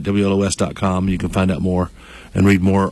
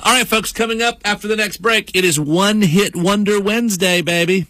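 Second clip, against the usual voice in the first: high-pitched host teaser before the break; high, sing-out morning-show plug; radio promo; 9 semitones higher than usual. Acted. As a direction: hype the coming segment in a raised radio voice. Promotes upcoming segment.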